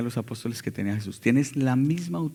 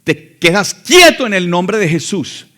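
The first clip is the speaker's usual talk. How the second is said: slow, much louder authoritative command; commanding rebuke; 4 semitones higher, 12 dB louder, slower than usual. Retold or imitated. Church lady rebuking the possessed father, loud slow command. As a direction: rebuke him with full authority. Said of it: "y dijo".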